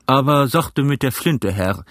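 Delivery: captain calling a warning. A narrator's reading. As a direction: call out the warning firmly. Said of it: "rief der Kapitän".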